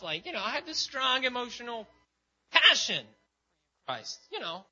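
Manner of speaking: high, emotional Peter voice building in force; pleading Peter. Acted. Preacher acting Peter protesting his love to Jesus, high and pleading.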